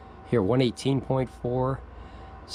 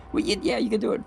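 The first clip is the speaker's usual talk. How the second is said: higher-pitched pep-talk voice, lightly put on; mock motivational tape; 8 semitones higher, faster than usual. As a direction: imitate a motivational tape cheering someone on. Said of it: "Be a motivational tape".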